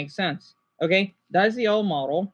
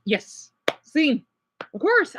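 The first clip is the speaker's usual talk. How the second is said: usual speaking voice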